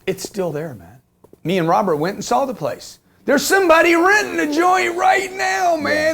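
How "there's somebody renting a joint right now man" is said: high, loud burst of excitement; excited outburst. Real, not acted. Telling a friend about his old rental, excited and loud. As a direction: blurt it out in amazement, much higher.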